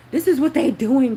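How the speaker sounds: loud emphatic repeat; emphatic shout. Real, not acted. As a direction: hammer the point home. Repeat of line 42 louder.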